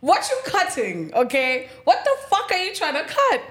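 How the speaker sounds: high-pitched indignant outburst; incredulous outrage. Retold or imitated. Tenant challenging caretaker over the cut, incredulous outrage. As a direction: demand it in outraged disbelief.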